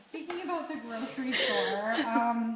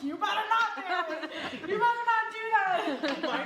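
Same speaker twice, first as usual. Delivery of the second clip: mock scolding parent; 9 semitones higher than usual. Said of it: "Just like".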